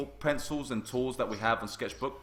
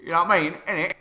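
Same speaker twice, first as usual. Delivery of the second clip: loud, higher mock Cockney; 5 semitones higher, 7 dB louder, faster than usual. Streamer jokes about people's idea of the accent, hammed-up Cockney. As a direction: ham up a Cockney catchphrase. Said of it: "what people think of when you say".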